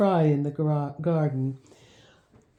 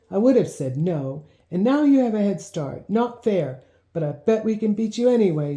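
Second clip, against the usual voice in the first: plain reading voice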